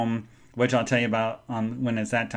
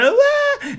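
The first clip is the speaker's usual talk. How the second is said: very high mock bawl; sudden high-pitched crying imitation; imitated child's wail; 4 dB louder than usual. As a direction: wail like a crying little boy. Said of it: "and he is crying".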